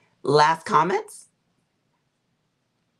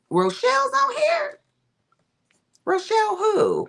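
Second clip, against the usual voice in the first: high, loud mock surprise; surprised; 4 dB louder than usual. Real, not acted. Spotting a viewer in the chat, high surprised squeal. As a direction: squeal the name like you didn't expect her. Noticing a viewer's comment live.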